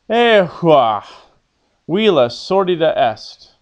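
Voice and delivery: loud, emphatic model line for the class to repeat; projected Latin model line; teacher's model reading. Shown as a demonstration. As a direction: read it out clearly as the character, with feeling. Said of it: "I'll read what the character says".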